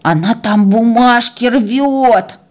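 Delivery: slow, drawn-out dog voice; tattling dog. Acted. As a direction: report the mischief, stretching every word. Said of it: "выскочил Куба и сообщил". (протяжно ябедничает)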